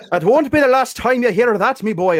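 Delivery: high put-on Irish brogue; Irish accent. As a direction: play a tipsy Irish cop. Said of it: "slightly inebriated Chief O'Hara".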